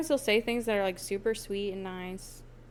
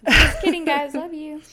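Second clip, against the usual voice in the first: playful laughing; 7 semitones higher, 12 dB louder than usual. Real, not acted. Playful recovery to audience.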